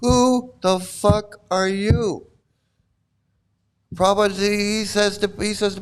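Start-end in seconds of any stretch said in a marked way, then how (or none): 0.0-2.3 very slow, word by word; drawn-out, deliberate challenge; slow indignant challenge. Acted. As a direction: space out each word for mock menace. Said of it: "what he's saying".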